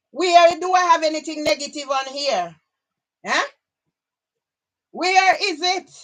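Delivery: loud, high, indignant demand; exasperated. Real, not acted. Ranting at online critics, exasperated and indignant. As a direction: throw the question out in real indignation.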